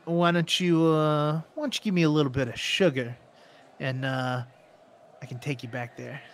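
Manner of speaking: lightly voiced sleazy gambler, hesitant; a hint of an oily come-on; flirting gambler. Game master plays flirting gambler.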